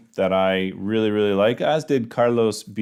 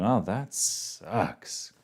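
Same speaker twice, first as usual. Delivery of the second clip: soft, slow, drawn-out "Oh, that sucks"; casual slang; 9 dB quieter, much slower than usual. Shown as a demonstration. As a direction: toss it off, deflated and very slow. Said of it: "with me, you're gonna be saying".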